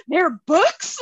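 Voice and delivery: shaken dad. Retold or imitated. Dad learns the show has books, shaken. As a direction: imitate a dad appalled by the news. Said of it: "he was just, like, so shooken".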